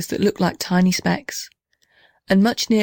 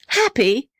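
higher, incredulous echo; surprised cry; 6 semitones higher than usual. A narrator's reading. Little house answering the blue gum, surprised cry. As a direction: throw the word back in happy disbelief. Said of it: "cried the little house".

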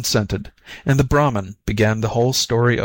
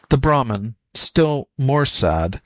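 ordinary delivery

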